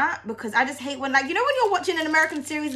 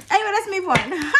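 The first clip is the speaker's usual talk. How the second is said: laughing; 6 semitones higher than usual. Real, not acted. Laughing off the tangent.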